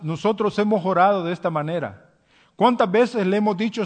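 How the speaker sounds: normal talking voice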